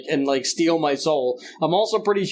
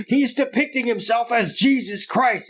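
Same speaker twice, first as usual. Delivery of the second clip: higher, scandalized dad; 6 semitones higher than usual. Retold or imitated. Dad accusing the singer.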